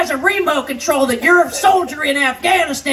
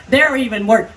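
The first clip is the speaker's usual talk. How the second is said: usual speaking voice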